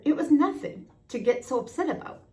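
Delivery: ordinary delivery, nothing marked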